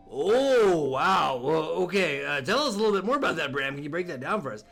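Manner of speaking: deep host voice. Pretend. Deep interviewer voice.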